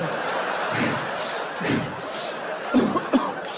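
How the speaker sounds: noisy mock panting; pug-like heavy breathing. Comedian imitating himself during sex, pug-like breathing. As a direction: breathe hard and snuffly, like a pug. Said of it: "breathing in her ear like a pug".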